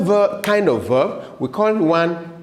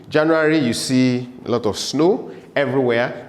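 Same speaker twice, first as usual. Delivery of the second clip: normal talking voice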